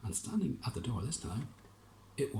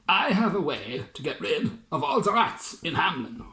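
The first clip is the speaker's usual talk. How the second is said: louder, stiff military general; proud general; 7 semitones higher, 7 dB louder than usual. Acted. Army general telling the mayor his plan, louder. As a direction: announce it like a soldier.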